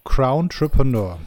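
slow and carefully spelled out. Shown as a demonstration. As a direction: say the name slowly, syllable by syllable. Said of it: "muss ich ganz langsam sprechen".

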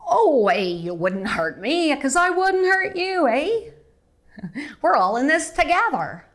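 bouncy high Canadian accent, lilting eh. Shown as a demonstration. To host, mocking a friendly Canadian accent. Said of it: "I'm from Southern Montreal, South slips in".

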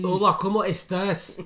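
put-on loud, high Scottish-tinged Mexican accent; mock Scottish-Mexican accent, belted out; [name]. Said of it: "That's [name]'s, uh, fucking impression".

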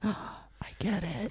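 very soft, drawn-out mock realization; hushed realization. Retelling a friend finally seeing the meme, slow hushed realization. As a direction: breathe it out slowly, as if it dawns on you.

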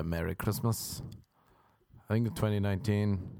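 normal talking voice

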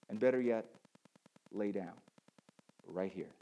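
low, soft, unhurried invitation; hushed voice, pausing between phrases; God whispering gently. Acted. God inviting him to rest, softly hushed. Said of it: "God is whispering in my ear".

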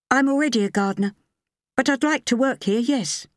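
high, steady woman's character voice; woman applicant. A narrator's reading.

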